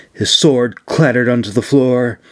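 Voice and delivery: ordinary delivery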